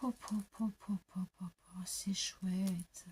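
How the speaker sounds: soft breathy-free whispering, almost to herself; awed whisper. Real, not acted. Showing her craft haul to viewers, whispering in awe.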